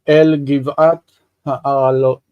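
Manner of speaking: ordinary talk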